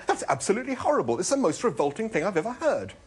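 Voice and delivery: high-pitched, rushed revulsion; appalled exclamation. A narrator's reading. Arthur revolted by the talking meal, appalled. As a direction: blurt your disgust high and fast. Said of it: "exclaimed Arthur".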